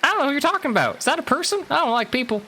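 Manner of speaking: very high-pitched little bird. Acted. Bird who doesn't like people, high bird voice. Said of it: "This bird says".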